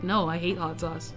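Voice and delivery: ordinary delivery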